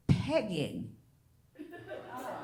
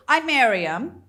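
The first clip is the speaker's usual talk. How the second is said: rising louder through the list; building to a shout; 6 semitones higher, 10 dB louder than usual. Acted. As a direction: build up and yell the last line.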